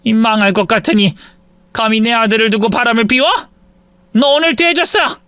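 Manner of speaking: rising to a high furious yell; mother-in-law screaming at her cheating daughter-in-law; furious scolding. Acted. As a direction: curse her out and explode at the end.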